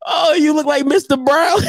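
laughing shout; laughing roast. Real, not acted. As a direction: crack up mid-insult. Roasting photo.

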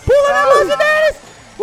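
excited shout. Real, not acted. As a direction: scream it like a dare. Hyping teammate to go in.

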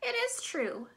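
butter up the dragon; flattering princess. Acted.

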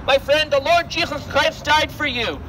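ordinary delivery

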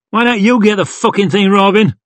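quick, high, aggressive retort; angry growl. A narrator's reading. Yon turns on Robin about the job, angry growl. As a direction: snap back fast and hard. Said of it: "growled Jolly Yon".

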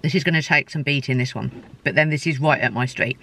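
chewing while talking; talking between bites; mouth full. Real, not acted. Seen 1: bit sandwich at -2, still chewing between words, sandwich in hand.

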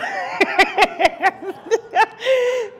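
a real high laugh. Real, not acted. Kids calling with problems.

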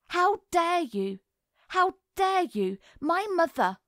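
high, indignant outburst; acted rage, pitch jumping high; spluttering outrage. A narrator's reading. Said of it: "she spluttered".

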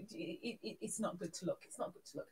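low, fading to whisper; hushed aside. Pretend. Streamer joking about Nobbles hooking up, hushed warning. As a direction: mutter it low, trailing into whisper.

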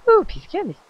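very high, squeaky put-on voice; squeaky ooh. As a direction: squeak it out like a cartoon. Repeats candy line in a squeaky voice.

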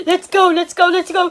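quick repeated eager chant; a lightly voiced Patrick hurrying. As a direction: bounce with impatience.

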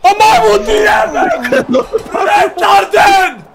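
furious gamer yelling insults; angry shouting. Real, not acted. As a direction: scream the insults in rage. Raging gamer loses round.

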